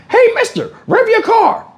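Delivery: put-on very high squeaky child; kid voice. Kid hanging in window begging, squeaky high. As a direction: squeak it like a pestering little kid.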